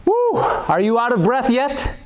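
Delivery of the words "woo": high, loud cheer of relief; excited whoop. Real, not acted. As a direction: let out a joyful whoop. Cheer after the round.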